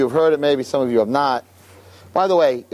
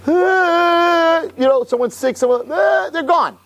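much higher and louder, a mock panicked cry; 4 dB louder, much slower than usual. Lecturer acting out a tough guy's panic, high and loud. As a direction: throw up your hands in panic.

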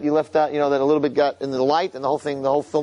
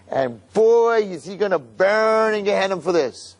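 higher, slow, smug prosecutor showing off; gloating Satan; 5 semitones higher, slower than usual. Acted. Satan presenting the evidence to the judges, smug and slow. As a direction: savor every detail of the sin. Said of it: "his face when he's like".

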